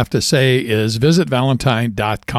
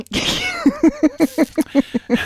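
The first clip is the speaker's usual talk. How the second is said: high burst of laughing; high laughter. Real, not acted. Laughs at own joke.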